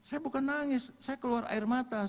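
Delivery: high-pitched retold denial; indignant protest. Soldier denies crying to teasing comrade, protesting.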